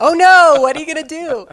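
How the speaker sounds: loud, high playful "oh no"; mock alarm. Mother relishing her favourite parenting line, mock alarm. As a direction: exclaim it with gleeful mock dismay.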